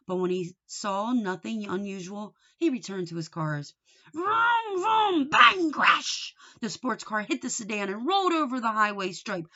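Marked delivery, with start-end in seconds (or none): 4.1-6.3 loud, high vroom then bang and crash; crash noises. A narrator's reading. Boy crashing toy cars, loud high crash noises. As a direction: shout the toy-car crash. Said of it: "making noise with his mouth".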